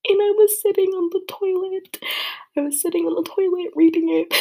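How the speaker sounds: high, loud, tearful voice; crying. Real, not acted. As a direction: let the tears break through. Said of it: "didn't even stopped crying".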